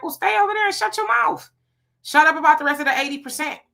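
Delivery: high-pitched, exasperated comeback; mock indignant retort. Host answers a man generalizing about his people, mock indignant retort.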